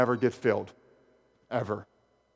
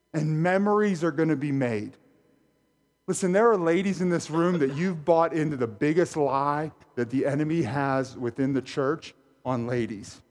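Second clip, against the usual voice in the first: ordinary talk, nothing marked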